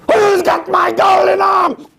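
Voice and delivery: high, loud, slow ghostly shriek. Acted. Ghost outside the house demanding its arm, high shriek.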